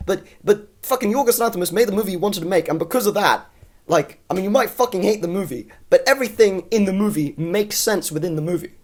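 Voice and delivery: ordinary talk, nothing marked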